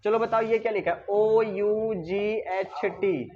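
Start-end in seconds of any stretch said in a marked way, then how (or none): 1.1-3.3 very slow, drawn-out letters; spelling out. Shown as a demonstration. Teacher spells a word for the class, very slow separate letters.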